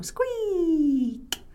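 long, high creak sliding down; door squeak. Acted.

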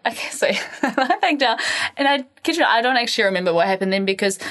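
ordinary talk, nothing marked

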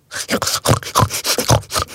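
voiceless imitated hedgehog snuffles; hedgehog snuffling. A narrator's reading. Hedgehog in the garden at night, imitated snuffling. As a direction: series of short animal snuffles. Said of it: "Went the hedgehog".

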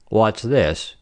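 low, peevish official; querulous. A narrator's reading. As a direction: query it with grumpy displeasure. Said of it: "said God One querulously".

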